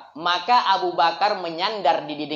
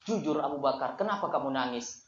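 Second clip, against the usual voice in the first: much softer and lower, coaxing the truth; soft urging; 7 semitones lower, 9 dB quieter than usual. Acted. The Prophet quietly urges Abu Bakar to tell the truth. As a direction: coax him gently to be honest.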